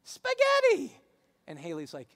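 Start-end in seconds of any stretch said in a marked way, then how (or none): 0.0-1.0 put-on very high, loud child squeal; shrill-high mock kid shout; little kid. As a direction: mimic a toddler proudly calling out a word. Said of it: "And the kid goes".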